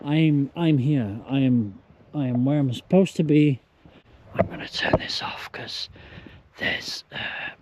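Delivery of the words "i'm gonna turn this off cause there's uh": hushed whisper to not scare people. Real, not acted. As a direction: whisper it quickly. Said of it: "I don't wanna scare them".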